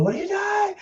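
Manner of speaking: imitated screech, held back in volume; mock scream. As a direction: shoot up into a retold shriek. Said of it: "She's, like, screaming".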